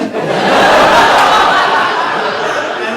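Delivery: bright breathy burst of laughter; amazed laugh. Real, not acted. Teacher recalling the miracle, laughing out loud. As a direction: laugh in disbelief at the memory.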